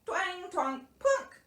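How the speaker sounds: high twanging plucks; tiny banjo imitation. A narrator's reading. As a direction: mimic a little banjo. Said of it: "strummed heartily on a tiny banjo".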